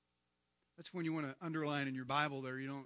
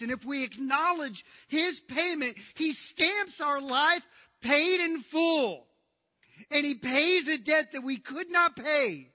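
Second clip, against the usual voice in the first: loud, higher-pitched sermon delivery; impassioned pulpit proclamation; full-force preaching; 9 semitones higher, 13 dB louder, slower than usual. Acted. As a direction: preach it at full voice, stretching the big phrases.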